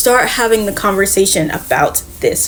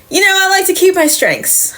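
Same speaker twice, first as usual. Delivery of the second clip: high-pitched, mock-casual comeback; breezy defiant retort; 10 semitones higher than usual. Circe answers Apollo in hell, breezily defiant. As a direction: toss it back in a much higher voice.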